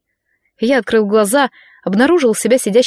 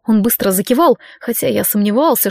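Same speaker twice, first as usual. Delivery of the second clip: plain reading voice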